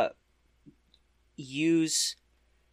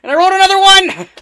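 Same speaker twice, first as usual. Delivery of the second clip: very high, loud, fast outburst; real high-pitched yelp of disbelief; exasperated shout; 16 dB louder, faster than usual. Real, not acted. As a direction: shriek it in dismay at the bad roll. Player reacts to rolling a one.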